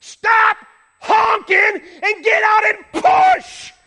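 loud, high, slow furious bellow; furious shout. Retold or imitated. Driver yelling at the honking man behind, furious shout. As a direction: roar the order at him. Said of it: "And I'm like".

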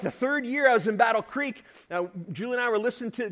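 usual speaking voice

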